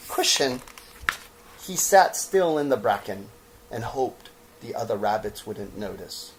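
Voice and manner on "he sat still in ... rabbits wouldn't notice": usual speaking voice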